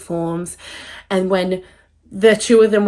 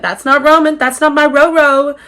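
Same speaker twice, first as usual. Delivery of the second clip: distressed protest; 7 semitones higher, 8 dB louder than usual. Retold or imitated. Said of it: "I was like".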